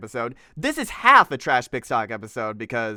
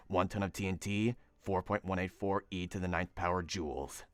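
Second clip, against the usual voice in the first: very low, hushed superhero growl; deep, quiet mock-serious voice; Batman; 8 semitones lower, 13 dB quieter than usual. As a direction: read the science text as a brooding dark vigilante. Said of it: "read this in my Batman voice".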